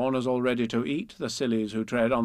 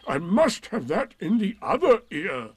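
raised, louder donkey voice; Eeyore; 8 semitones higher, 6 dB louder than usual. A narrator's reading. Eeyore switches ears to hear Piglet, loud and laboured. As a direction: announce it loudly, with effort. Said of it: "he said".

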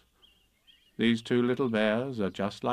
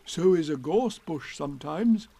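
Pooh voice; 5 semitones higher than usual. Acted. Pooh replies.

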